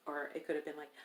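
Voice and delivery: usual speaking voice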